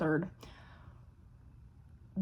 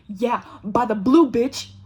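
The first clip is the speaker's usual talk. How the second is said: loud, slow, triumphant boast; brash announcement; 2 semitones higher, 8 dB louder, slower than usual. Retold or imitated. Stranger from the bar announcing her Jeep, brash shout.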